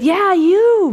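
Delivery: God's "yeah, you" called out high and loud; God to Moses, insisting he is the one, calling out; emphatic God. Acted.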